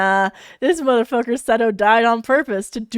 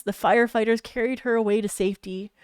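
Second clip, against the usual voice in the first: ordinary delivery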